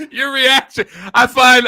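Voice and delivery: loud, high, amused outburst; laughing. Real, not acted. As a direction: burst out laughing, then talk over it. Cracking up at co-host's reaction.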